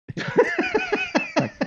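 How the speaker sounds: high-pitched burst of laughter. Real, not acted. Host laughs at his favorite-records joke, high-pitched. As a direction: crack up, high and light.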